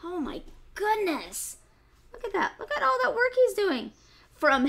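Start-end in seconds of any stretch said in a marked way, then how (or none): none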